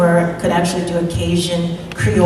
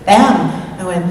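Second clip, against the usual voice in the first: usual speaking voice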